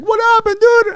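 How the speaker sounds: very high, loud mock squeal; excited asking. Cellmate Matt greeting him back, excitedly curious. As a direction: demand the news in a high yelp. Matt eagerly asks for news.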